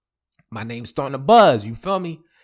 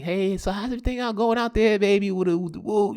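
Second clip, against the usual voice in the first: put-on girlfriend voice; 7 semitones higher, faster than usual.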